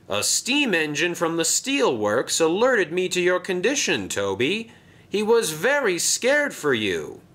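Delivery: a concerned boss, lifted voice; Sir Topham Hatt. Acted.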